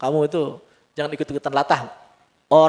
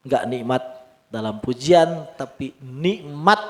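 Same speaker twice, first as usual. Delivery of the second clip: normal talking voice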